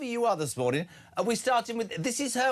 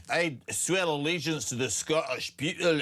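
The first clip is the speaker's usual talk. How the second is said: mock Scottish oath, pitched down; Braveheart Scottish accent; 4 semitones lower than usual. Host imitating new MP swearing allegiance, mock Scottish accent. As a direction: put on a hammy Scottish accent. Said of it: "sound like Braveheart".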